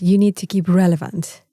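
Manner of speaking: ordinary delivery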